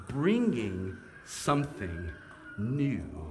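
"new" drawn out and leaned on, slow; stress on new. Shown as a demonstration. Preacher to congregation about Jesus, stressing "new". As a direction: land hard and slow on "new". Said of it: "I emphasize that word new".

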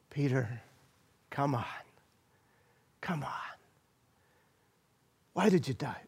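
hushed, gentle coaxing, trailing into a whisper; tender quiet Savior. Acted. Jesus gently calling sinking Peter, tender hush. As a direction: coax Peter softly up out of the water. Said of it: "my Savior says to him".

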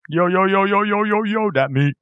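high, sustained rapid-fire repeats; goofy greeting. Acted. Co-host answers the introduction, goofy high-pitched hello. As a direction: chant it on one high note.

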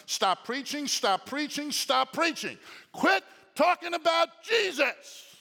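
loud, rising, high commands; officials ordering. Retold or imitated. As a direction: bark the order to stop preaching. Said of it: "You know what they're telling him?".

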